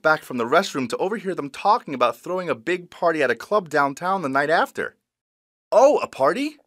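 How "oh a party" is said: surprised question. Retold or imitated. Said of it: "I asked".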